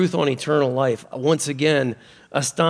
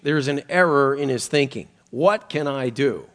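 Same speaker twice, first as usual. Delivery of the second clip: normal talking voice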